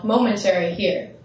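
ordinary talk

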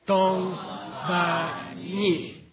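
Tong Ba Ni said very slowly, each syllable separate; very slow careful Tibetan syllables; slow Tibetan reading. Shown as a demonstration. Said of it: "In Tibetan and in English".

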